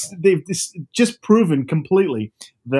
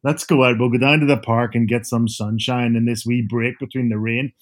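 Belfast accent; 2 semitones lower than usual. Shown as a demonstration. Father back home in Belfast greeting his kids in a Northern Irish accent. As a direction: slip into broad North Belfast talk for the kids. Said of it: "I'm talking like this".

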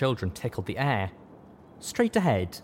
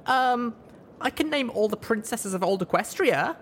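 put-on higher child voice, unsure then eager; hesitant little colt; 13 semitones higher than usual. A narrator's reading. Little colt trying again to impress his sister. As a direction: try again, a bit unsure.

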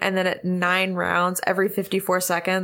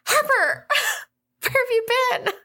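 excited. Acted. Relieved shout to lost friend.

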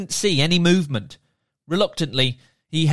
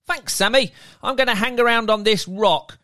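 merman; 6 semitones higher than usual. Acted. Merman character voiced.

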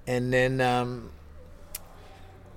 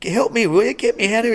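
high, louder mock plea for rescue; pitched-up comic desperation; urgent pleading; 10 semitones higher, 5 dB louder than usual. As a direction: beg an ally to get you out, hammed-up. Acting out his plea for rescue.

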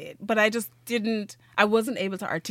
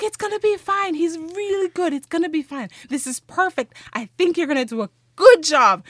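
reassuring friend; 9 semitones higher, 5 dB louder than usual. Retold or imitated. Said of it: "And she's like".

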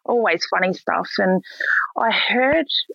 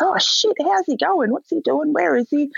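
much higher, eager rapid-fire questions; excited recognition; 10 semitones higher than usual. Retold or imitated. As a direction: gush like he's found an old mate's kid. Officer thrilled to hear about dad.

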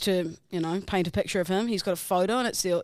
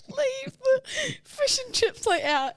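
usual speaking voice

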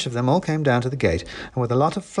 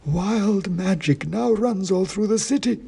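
high, slow, dusty oracle voice; dusty faint ancient head; 9 semitones higher, slower than usual. A narrator's reading. Ancient king's head answering the wizard, slow high dusty chant. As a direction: intone it like a faint, dusty myth. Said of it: "said the king grimly".

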